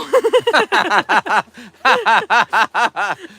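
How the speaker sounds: loud laughing fit. Real, not acted. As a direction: laugh hard for a while. Laughing at her own story.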